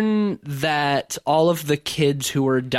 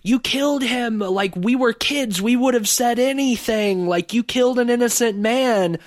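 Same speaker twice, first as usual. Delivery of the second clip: mock-yell it as a high, accusing movie line; imitando grito de acusação; 9 semitones higher than usual. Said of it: "at one point starts yelling".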